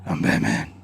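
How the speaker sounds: very low, soft Batman rumble. Retold or imitated. Showing the Batman voice against the criminal one, low Batman rumble. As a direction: drop way down into a hushed Batman. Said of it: "And Batman sounds like this".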